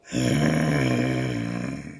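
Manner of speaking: long ghostly low moan; drawn-out haunting moan; eerie low moan. Acted. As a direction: moan like a spirit in the dark. Eerie sound in the night.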